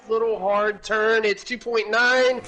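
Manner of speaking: high excited race call; motorsport commentator. Real, not acted. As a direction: build the tension toward the line. Live finish-line play-by-play.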